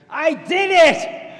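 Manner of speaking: high, loud, slow cry of victory; triumphant shout. Retold or imitated. Client shouting her victory in the mall hallway, loud triumphant shout. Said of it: "she shouted out for everybody to hear".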